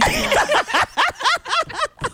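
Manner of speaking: high burst of real laughter. Real, not acted.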